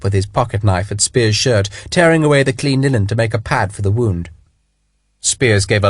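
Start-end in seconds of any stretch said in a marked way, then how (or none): none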